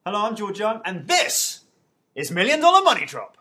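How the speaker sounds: big, loud, high game-show intro; show host catchphrase. Shown as a demonstration. Host gives viewers his favourite catchphrase, booming show-host delivery. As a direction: belt out the show title like a host. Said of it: "favourite catchphrase at the moment".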